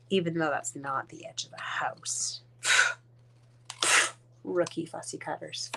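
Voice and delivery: unvoiced whispered aside. Real, not acted. Whispering to the viewers about the catalog photo. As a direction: slip it in under your breath.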